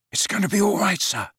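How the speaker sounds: high, urgent comforting; worried reassurance. A narrator's reading. Worried boy comforts his silent headmaster, anxiously reassuring. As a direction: reassure him with worry showing. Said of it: "more worried by Dumbledore's silence".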